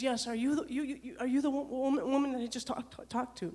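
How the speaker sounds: imitated caller stammering, restarting words; stuttering. Distressed caller phoning the dispatcher back, stuttering. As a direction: stumble and repeat your way through the question. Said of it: "Now she's stuttering".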